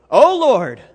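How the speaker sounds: a loud, pleading call upward; loud cry. Acted. Preacher voicing the prophet's cry to God, loud. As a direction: cry out to God across the room.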